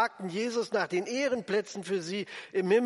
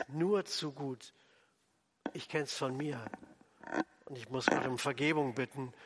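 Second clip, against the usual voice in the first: ordinary delivery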